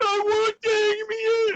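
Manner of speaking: put-on squeaky high toddler whine; a child begging for toys, very high-pitched; whiny little kid. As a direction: mimic a demanding little kid. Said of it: "He'd be like".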